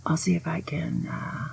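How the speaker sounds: whispered mock threat; whispering like a mob boss; conspiratorial whisper. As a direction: plot it under your breath. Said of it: "mock threat aside".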